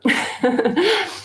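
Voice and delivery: a quick high giggle. Real, not acted. Host laughing about partner Rob being a master popcorn popper, high giggle.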